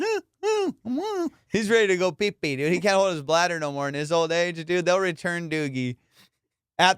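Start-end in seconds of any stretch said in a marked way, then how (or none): 0.0-1.3 high imitated dog huffing; old dog panting. Imitating his old dog waking him, high panting. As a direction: pant like an old dog, pitched way up.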